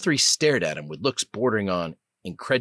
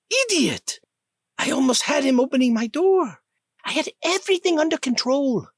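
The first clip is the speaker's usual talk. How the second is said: put-on high, indignant British-accented scolding; furious British prisoner snapping at her clumsy rescuer; angry British woman; 5 dB louder than usual. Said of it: "She had a clipped accent that sounded vaguely British".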